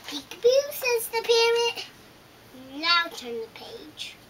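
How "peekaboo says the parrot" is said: high and loud peekaboo after a gasp; excited reveal. Acted. Gasping reveal of the parrot to the toddler, excited. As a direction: surprise the child with the parrot.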